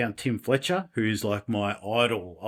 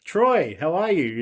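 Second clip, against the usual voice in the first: higher, louder imitation of a Canadian greeting; Tim Fletcher's Canadian accent; 5 semitones higher, 4 dB louder, slower than usual. Idol greets a fan by phone, imitated Canadian accent. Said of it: "in his Canadian accent".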